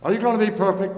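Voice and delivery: loud preaching question. Acted.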